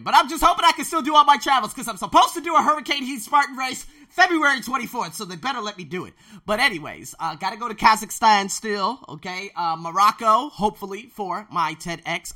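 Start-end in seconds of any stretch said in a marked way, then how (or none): 0.0-9.0 much higher, loud and fast excited burst. Real, not acted. Vlogger hypes his upcoming race, loud and fast. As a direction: gush about the trip, racing. Excited rant about travels.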